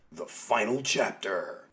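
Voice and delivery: low, slow mock-announcer voice; dramatic title voice. Host announcing the comic's title, low and dramatic. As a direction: drop low and draw the title out.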